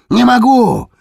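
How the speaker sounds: high shriek. Acted. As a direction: squeal it out in panic. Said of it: "возвизгнул он".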